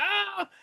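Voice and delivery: short high mock scream of fright; retold scream. Boy sees hanging bodies, high mock scream. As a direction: yelp as if seeing hanged bodies. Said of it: "He's freaking out".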